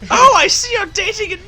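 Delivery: high shocked granny. Acted. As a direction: gasp out like a prim granny. Voicing granny then scolding.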